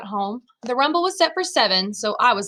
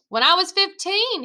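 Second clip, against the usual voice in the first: yelling back; 8 semitones higher than usual. A narrator's reading. Brother answers through the house, mildly yelling back. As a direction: call the answer back, higher. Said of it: "he yelled back".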